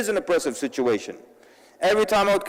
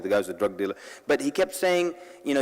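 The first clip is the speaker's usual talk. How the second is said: ordinary delivery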